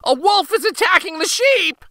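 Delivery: high, loud cry of alarm; urgent high-pitched boy's call; crying out for help. A narrator's reading. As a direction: shout desperately for help as the boy. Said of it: "cried the boy".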